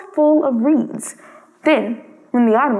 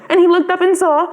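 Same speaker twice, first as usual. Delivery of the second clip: usual speaking voice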